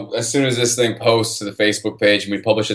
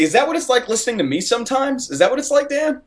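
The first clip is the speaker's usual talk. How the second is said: amused disbelief, pitched way up; incredulous, much higher; 12 semitones higher than usual. Real, not acted. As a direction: tease him with high mock outrage. Incredulous teasing question.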